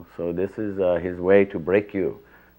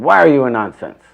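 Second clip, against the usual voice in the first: much louder and higher, scolding WHY; retold angry yell; 6 semitones higher, 12 dB louder than usual. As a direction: yell it at the cornered student. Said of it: "to get yelled at".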